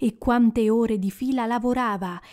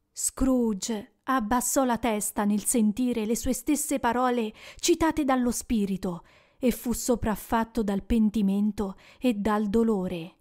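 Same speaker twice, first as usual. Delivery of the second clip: ordinary delivery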